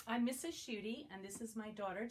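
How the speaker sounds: ordinary delivery, nothing marked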